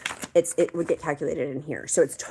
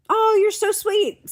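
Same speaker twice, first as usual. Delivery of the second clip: touched surprise; 6 dB louder than usual. Real, not acted. Touched by viewer compliment.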